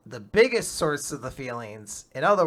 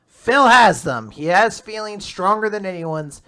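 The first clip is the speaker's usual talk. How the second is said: excited dramatic emphasis; emphatic reveal; 7 semitones higher, 10 dB louder than usual. Real, not acted. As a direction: land the big twist with force. Climactic reveal in chapter recap.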